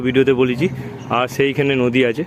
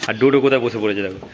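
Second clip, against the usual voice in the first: ordinary talk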